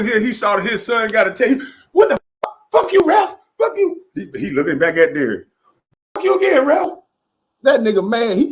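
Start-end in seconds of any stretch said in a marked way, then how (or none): 1.9-4.0 imitating Matt Barnes cursing out the referee; mock courtside rage. Imitating Matt Barnes yelling at ref. 6.1-6.9 imitating Matt Barnes cursing out the referee; mock courtside rage. Imitating Matt Barnes yelling at ref.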